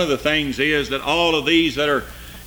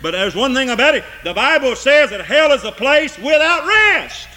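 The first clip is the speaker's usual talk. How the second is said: soaring, loud hellfire preaching; preaching at full force; 10 semitones higher, 5 dB louder than usual. Acted. Preacher warning his congregation of hell's torment, full force. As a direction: preach the torment, voice climbing high. Preacher declaring hell restless.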